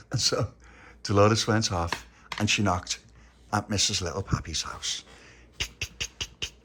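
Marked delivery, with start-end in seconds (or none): none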